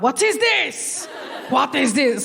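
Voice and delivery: put-on high, drawn-out Moroccan-accented disbelief; mock Moroccan audience accent. Moroccan audience rejecting intelligent jokes, mock accented bafflement. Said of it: "They're like".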